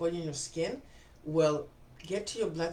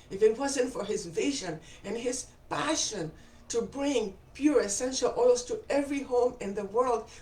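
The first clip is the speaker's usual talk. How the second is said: emotional; 5 semitones higher, 3 dB louder than usual. Real, not acted. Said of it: "Okay, I'm not getting emotional".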